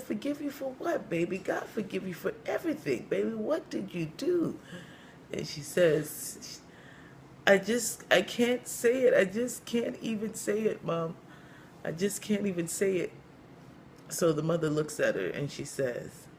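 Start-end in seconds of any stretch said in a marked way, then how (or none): 0.0-4.6 high, cracked elder's questioning; cracked-voiced church mother. Church mother questioning the guilty young woman, high cracked voice. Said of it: "she has a really cracked voice". 7.5-13.1 high, lightly acted distress; mock tearful. Young woman unable to confess to church mother, mock tearful. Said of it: "as she's crying".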